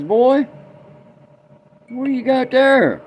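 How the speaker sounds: put-on high, loud hail to the boy; old man calling out. Old man on porch calls to boy, high loud voice. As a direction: call the kid over with interest.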